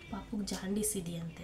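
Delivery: hushed, voiceless whisper of bad news; retold whisper. Husband quietly breaks news of baby's jaundice, whispering. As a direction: whisper it as if not to alarm her.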